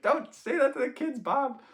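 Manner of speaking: high scolding grandma voice; mock angry grandma. Grandma scolding grandpa over the kids, mock angry high. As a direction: play the cross grandma scolding. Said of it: "My grandma would be mad".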